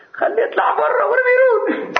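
high-pitched yell, imitating an old man; retold angry shout. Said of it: "داد زد سر این بچه".

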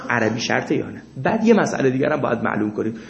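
ordinary delivery

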